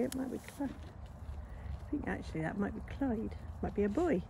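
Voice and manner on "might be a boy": ordinary talk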